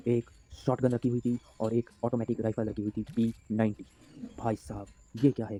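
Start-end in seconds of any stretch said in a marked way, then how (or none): none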